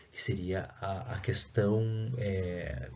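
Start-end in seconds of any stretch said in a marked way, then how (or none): none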